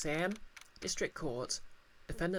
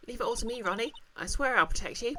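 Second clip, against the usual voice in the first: higher, sweet protective promise; a lightly sweet wife vowing to protect; doting wife; 6 semitones higher than usual. Acted.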